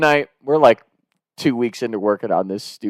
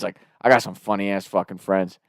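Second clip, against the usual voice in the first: ordinary talk, nothing marked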